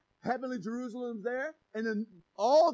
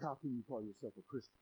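low, soft, fast accusing voice of the devil; Satan voice; 12 semitones lower, 13 dB quieter, faster than usual. Acted. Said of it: "Any resemblance is purely coincidental".

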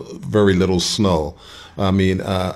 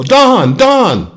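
calling out; 7 dB louder than usual. Retold or imitated. Someone calling from across street.

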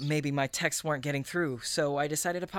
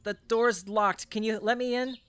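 high, raised voice through a door; calling out; 6 semitones higher than usual. Real, not acted. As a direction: call out to someone inside.

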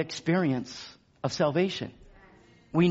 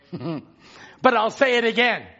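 ordinary delivery

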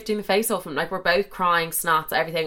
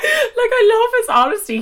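laughing outburst; 6 dB louder than usual. Real, not acted. Bursting out after laughing.

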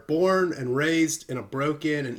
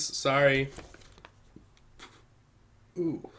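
normal talking voice

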